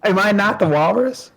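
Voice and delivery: high put-on walrus character voice. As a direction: squeak it out as the cartoon walrus. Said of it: "I'm the fucking walrus voice".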